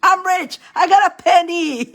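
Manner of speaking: high, laughing mock brag; mock boast, laughing. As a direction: boast of one penny as if it's a fortune, giggling. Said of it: "you're wealthy".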